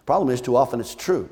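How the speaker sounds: ordinary talk, nothing marked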